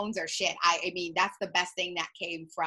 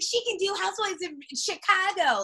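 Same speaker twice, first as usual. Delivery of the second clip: mock fans; 11 semitones higher than usual. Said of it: "everybody's like".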